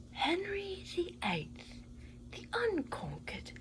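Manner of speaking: lofty, hushed royal announcement; grand British narrator. Pretend. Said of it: "narrate everything like the Great British Baking Show".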